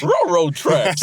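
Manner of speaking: high, slow, loud cartoon dog voice; Scooby-Doo. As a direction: say it like a talking cartoon dog, drawn out. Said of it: "I don't sound like Scooby-Doo".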